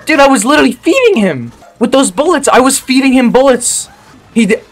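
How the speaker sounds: high, loud gamer rage rant; furious rant. Real, not acted. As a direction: rant at the screen in outrage. Ranting about surviving enemy.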